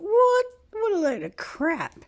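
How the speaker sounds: shrill disbelieving squawk, high and loud; mock outrage at the fake name; incredulous. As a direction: react in total disbelief.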